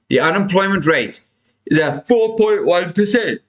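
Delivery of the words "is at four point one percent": through a yawn. Real, not acted. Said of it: "ignore that yawn".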